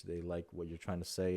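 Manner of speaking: normal talking voice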